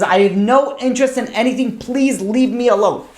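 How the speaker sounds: retold scream. Daughter shouting at the student behind the door, mock yell. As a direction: imitate yelling through a door without full force. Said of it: "she screams at him from behind".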